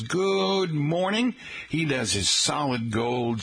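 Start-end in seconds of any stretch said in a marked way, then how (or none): 0.0-1.4 quoting Jim. Retold or imitated. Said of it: "Always checks in and says".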